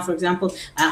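usual speaking voice

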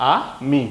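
"আ মি" said as two separate beats, আ then মি; broken into syllables. Shown as a demonstration. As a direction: pause between আ and মি. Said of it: "দুবার উচ্চারণ করতে হচ্ছে". ("আ মি" দুবারে ভেঙে উচ্চারণ)

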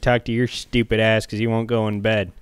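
angry Boston cop voice; gruff Massachusetts cop yelling; Massachusetts accent. Pretend. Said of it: "That's not a Massachusetts, uh, accent".

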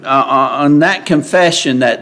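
ordinary talk, nothing marked

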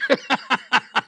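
high burst of laughter at his own punchline; real laugh. Real, not acted.

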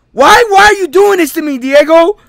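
high, loud anguished plea; dramatic anguish. Acted. [name] to Diego, loud hammed-up anguish. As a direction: overplay it for drama. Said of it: "for dramatical purposes".

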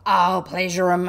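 loud, vengeful shout; forceful angry outburst; yelled threat. A narrator's reading. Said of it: "he yelled".

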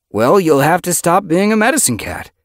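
high, loud voiced character; cat character voice. Ragged Pelt arguing.

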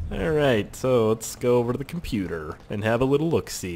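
normal talking voice

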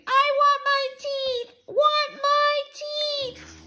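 put-on very high, slow toddler wail; Fudge screaming. A narrator's reading. Little brother wanting his teeth back, screaming. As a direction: bawl it out, dragging the words. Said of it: "Fudge started screaming".

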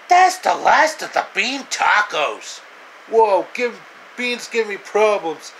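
very high, loud squeaky cartoon voice; high cartoonish squeal with laughter. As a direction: play a silly squeaky sidekick. Character voices arguing about beans.

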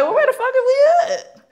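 very high, loud, comic shriek; alarmed disbelief. Retold or imitated. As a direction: squeal the question in hammed-up shock. Car screeches to a sudden stop.

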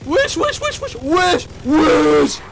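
very high loud frantic shrieks; begging the monsters to calm down; real terrified screaming at the game; panicked screaming. Real, not acted.

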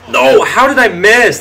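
high, loud, slow cry of disbelief; anguished shout. Real, not acted. Gamer yelling at his missed kick. Missed field goal again.

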